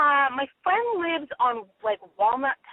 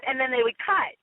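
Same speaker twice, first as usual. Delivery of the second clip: ordinary talk, nothing marked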